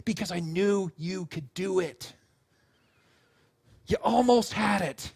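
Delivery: slow, tender, settled voice; unhurried, deliberate encouragement; reassuring parent. Retold or imitated. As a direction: imitate a parent gently building confidence. Parent consoling, after child's wail.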